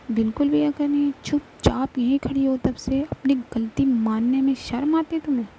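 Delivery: angry scolding. Acted. Mahi angrily berating Alka for not owning up. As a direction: tear into her for staying silent.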